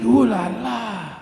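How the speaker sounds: slow hammed-up French accent; a light French purr. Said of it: "He's like".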